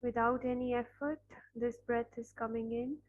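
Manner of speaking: ordinary talk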